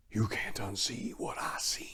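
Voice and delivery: haunted whisper. Acted. Narrator ending the tale on a haunted whisper. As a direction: breathe it out, haunted by the memory. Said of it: "haunted closing line".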